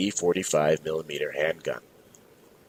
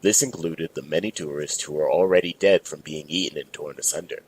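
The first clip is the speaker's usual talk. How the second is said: ordinary delivery, nothing marked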